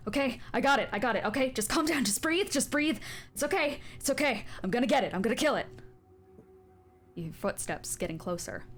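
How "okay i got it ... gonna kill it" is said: higher, louder, rushed reassurance; panicked man. Acted. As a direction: soothe her while shaking with panic. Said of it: "as he just goes".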